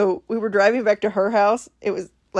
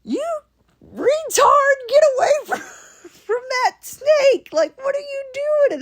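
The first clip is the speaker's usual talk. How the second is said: high-pitched mock dad shouting at daughter; dad yells at his daughter to get away from the snake, in a high mock voice; dad yelling; slower than usual. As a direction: squeak it out like a frantic dad. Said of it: "He's like".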